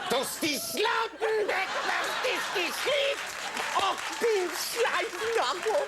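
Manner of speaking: high, slow mock-German shouting; shouted fake German. Host's joke dating-video pitch, shouted fake German. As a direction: rant like a hammed-up German commander. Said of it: "I'm always shouting on this show".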